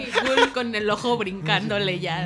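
normal talking voice

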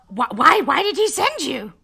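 lightly voiced suspicious goblin; a hint of nervous guard; wary guard. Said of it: "looks to you and says".

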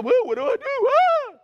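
high, loud panicked cry. Acted. A believer, attacked by the enemy, crying out in panic. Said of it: "you go".

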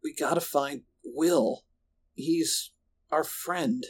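slow, soft, feeble plea; faint, labored and halting; weak moan. A narrator's reading. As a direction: drag the words out weakly. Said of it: "I moaned weakly".

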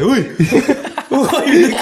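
a hint of a barking sergeant, laughing through it; stern sergeant. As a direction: call out like a strict sergeant catching someone. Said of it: "It's always the oi that's scary".

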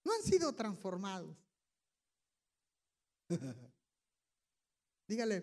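ordinary delivery

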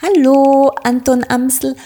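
high, loud calling witch voice. Acted. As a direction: call out like a little witch across the house. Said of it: "hörte er da auch schon die kleine Hexe Dorothee rufen". (laut rufende kleine Hexe)

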